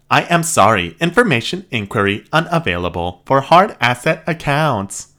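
loud, blaring robot repeat; robot cranks its volume up. Acted. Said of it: "Raising volume level now".

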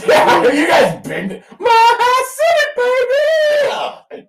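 high, loud arena yell; hype shout. Pretend. Mimicking the player firing up the fans, shouting. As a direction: bellow it like a hype man to the crowd.

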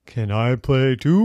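loud, eerie wail rising at the end; crazy ghost voice. Acted. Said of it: "you'd hear a crazy voice".

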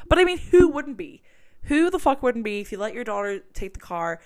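defending the mom, worked up; heated rant. Real, not acted. Defending the angry mom to viewers, heated rant.

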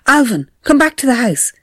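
mother calling her son from afar. A narrator's reading. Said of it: "Mother called out".

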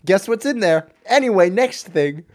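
loud, breezy tour guide; careless tour guide. Retold or imitated. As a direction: rush past like a bored guide. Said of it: "He's just like".

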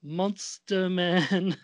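normal talking voice

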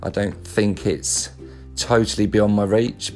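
ordinary talk

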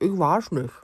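mumbling through a mouthful of apple; mouth full. Retold or imitated. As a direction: talk around a bite of apple. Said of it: "konnte beim „Ich weiß nicht“ genau sehen, dass da Apfelstücke".

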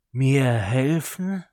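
loud growling snarl, slow; growling dog voice. A narrator's reading. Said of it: "ein knurrendes Flüstern".

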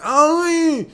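very high, loud "oy"; long high-pitched cry of pain, mimicked; imitated pain yelp. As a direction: imitate a hurt kid's yelp. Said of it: "That's the sound he made".

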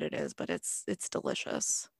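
breathy whispered delicious; whispered delight. Real, not acted. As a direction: whisper it like a treat. Savouring the drink.

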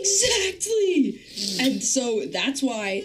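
slow, high stretched exactly; drawn-out emphasis. Real, not acted.

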